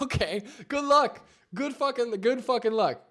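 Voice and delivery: high, loud scoffing send-off; sarcastic good wishes. Retold or imitated. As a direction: wish him luck in open disbelief. Sarcastic reply to celery juice diet.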